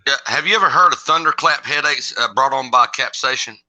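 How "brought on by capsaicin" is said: ordinary talk, nothing marked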